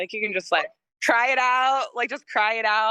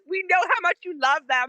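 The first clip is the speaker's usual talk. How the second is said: parents' voice; 8 semitones higher than usual. Retold or imitated.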